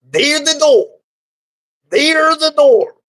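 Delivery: man kicking the vagina out, loud; angry order. Acted. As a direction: point at the door and bark. Angry man throws her out.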